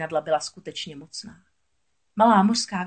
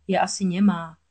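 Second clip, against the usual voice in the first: ordinary delivery, nothing marked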